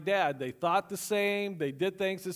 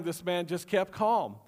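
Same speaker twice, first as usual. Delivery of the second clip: ordinary delivery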